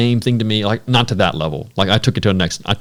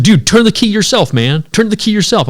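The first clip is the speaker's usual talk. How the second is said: high, loud insistence; exasperated insisting; 10 semitones higher, 4 dB louder than usual. Retold or imitated. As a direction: push back hard, near shouting. Insisting the truck won't start.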